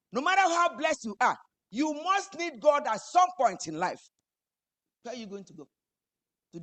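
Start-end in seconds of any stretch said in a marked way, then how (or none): 0.1-4.0 loud, high, impassioned preaching; fiery preaching. Acted. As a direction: preach it out with full force. Peak of sermon.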